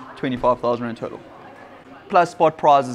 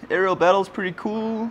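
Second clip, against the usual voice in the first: ordinary delivery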